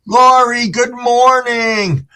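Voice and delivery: high, slow, stretched good morning; cheerful greeting. Real, not acted. Streamer spots a regular in chat and greets her brightly. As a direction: sing out a hello.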